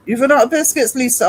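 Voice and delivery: high, loud, hammed-up dismay; mock alarm. To Lisa in chat about the biscuits, hammed-up alarmed cry. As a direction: cry it out like a tiny disaster.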